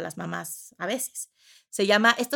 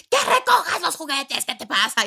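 much higher, laughing mock yell; mock angry yell; 7 semitones higher, 4 dB louder than usual. Mom finally exploding at child about toys, mock yell. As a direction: blow up at the kid. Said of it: "Y es como".

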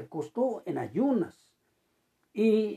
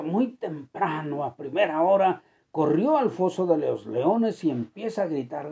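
ordinary talk

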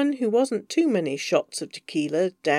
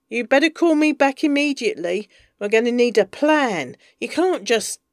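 louder, sharp and commanding; angry demand; 4 semitones higher, 6 dB louder than usual. Acted. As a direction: order him to call back, angry. Bees angry demand on phone.

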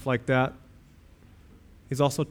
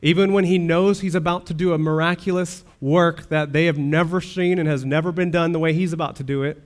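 usual speaking voice

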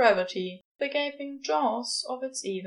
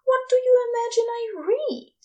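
higher, louder indignant retort; huffy; 14 semitones higher, 5 dB louder than usual. A narrator's reading. Angel affronted at being doubted, huffy and louder. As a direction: huff back, affronted. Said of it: "Aziraphale huffs".

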